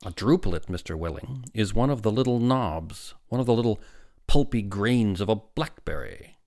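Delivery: loud, emphatic explanation; forceful lecturing. A narrator's reading. Doctor defines a druplet for father, forceful. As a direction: hammer out each phrase.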